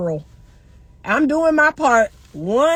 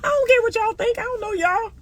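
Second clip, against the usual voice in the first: higher, laughing, breezy don't-care; carefree dismissive; 9 semitones higher than usual. Pretend. Older woman showing she doesn't care, laughing and high. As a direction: toss it off with a laugh. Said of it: "just being like".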